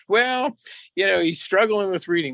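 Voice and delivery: a high, hesitant dad explaining; imitating dad. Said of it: "And my dad goes".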